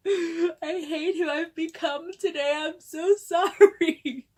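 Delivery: high, slow hammed-up sob; mock crying. Prankster apologizing after April Fool's, mock sobbing. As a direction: wail it through fake tears, swelling loud.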